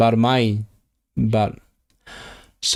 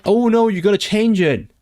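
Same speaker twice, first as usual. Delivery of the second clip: higher, louder burst of alarm; sudden dismay; 9 semitones higher, 4 dB louder than usual. Real, not acted. Learner realizing the word must change, dismayed. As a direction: caught off guard by a mistake.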